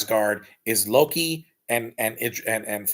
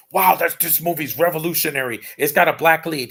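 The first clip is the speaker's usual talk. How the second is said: louder, higher retold excitement; mock amazement; 5 semitones higher, 6 dB louder than usual. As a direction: play the wowed movie fan. Acts out his impressed reaction.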